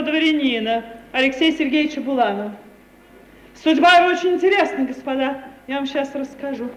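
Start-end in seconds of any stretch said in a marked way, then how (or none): 3.6-5.4 fast and louder; eager telling. Acted. Lady presenting the young nobleman to her neighbours, fast and eager. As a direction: rush into the story with relish.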